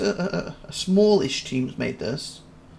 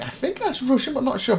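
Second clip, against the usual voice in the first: much higher, hesitant mock doubt; unsure guess; 11 semitones higher than usual. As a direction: guess without confidence. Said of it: "I was like".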